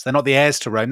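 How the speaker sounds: ordinary delivery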